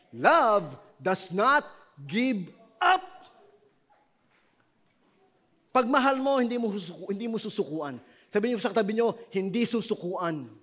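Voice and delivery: loud, driving sermon voice; fiery preaching. Acted. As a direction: preach it hard to the congregation. Pastor preaching at full force.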